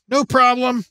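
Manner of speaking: pinched-nose voice; holding nose. Acted. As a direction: say it through a held nose. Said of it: "Matt held his nose".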